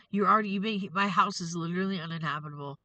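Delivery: ordinary delivery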